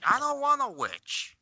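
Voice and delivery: high-pitched in-character whine; high protest. Acted. Character protests being offered to witch.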